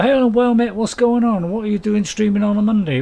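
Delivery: loud cheery chat greeting. Retold or imitated. Streamer voices a viewer's chat message, loud cheerful greeting. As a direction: boom out the viewer's greeting like a pal across a pub.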